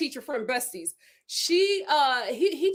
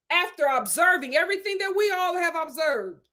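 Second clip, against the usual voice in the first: usual speaking voice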